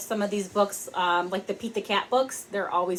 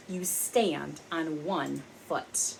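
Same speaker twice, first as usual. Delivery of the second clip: slow, low, soft grumpy toad; 5 semitones lower, 8 dB quieter, slower than usual. Acted. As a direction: grumble it out slowly like a sulky toad.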